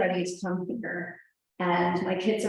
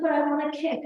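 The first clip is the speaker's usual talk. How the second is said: a hint of a little girl, pitched higher; lightly voiced four-year-old; 7 semitones higher, faster than usual. Four-year-old Ani telling mom what she wants, lightly childlike, higher. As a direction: lightly play the small daughter blurting it out. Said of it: "She's like".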